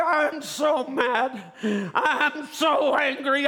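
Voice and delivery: slow, high, forceful anger; furious, gritted teeth. Retold or imitated. Preacher telling his wife how mad he is, slow furious cry. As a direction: drag the words out through gritted teeth. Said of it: "I was gritting my teeth".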